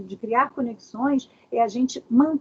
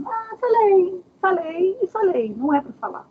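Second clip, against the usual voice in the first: playful defiance; 9 semitones higher, 5 dB louder than usual. Acted. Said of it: "a pessoa fala assim, na brincadeira".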